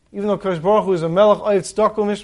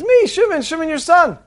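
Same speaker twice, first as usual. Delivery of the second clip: much higher, pleading cry up to the window; desperate pleading; 9 semitones higher than usual. Acted. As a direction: beg the father to recognize him.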